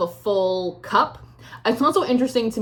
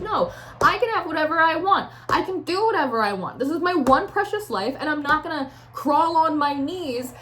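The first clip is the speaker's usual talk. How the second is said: higher-pitched, emphatic self-assertion; defiant independence; 6 semitones higher than usual. Acted. Querent claiming one precious life, emphatic high defiance. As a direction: declare it as your right.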